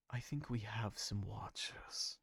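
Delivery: conspiratorial whisper. Acted. Apoxis quietly warning the council of watchers, whispered. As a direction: lean in and whisper it.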